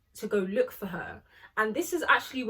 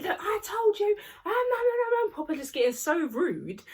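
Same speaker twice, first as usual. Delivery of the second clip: mock shouting; 10 semitones higher than usual. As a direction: throw Tilly's screaming back at them. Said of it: "shouting and screaming".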